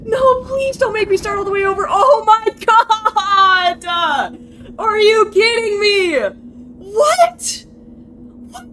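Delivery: high, loud wailing outburst; anguished disbelief. Real, not acted. Gamer losing saved progress, high wailing shouts. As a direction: shriek in horrified disbelief. Game failed to save progress.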